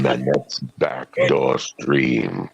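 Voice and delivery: low, very slow haunted-ride voice; spooky ride narrator. Pretend. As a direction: drag it out low like a ghost-train host.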